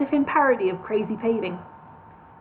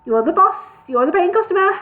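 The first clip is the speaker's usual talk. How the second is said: hurried; 8 semitones higher, 5 dB louder than usual. A narrator's reading. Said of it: "said Murray hurriedly".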